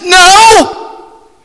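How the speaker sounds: high, loud emphatic shout; booming shout of denial. Acted. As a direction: slam the door on the idea. Preacher answering as God.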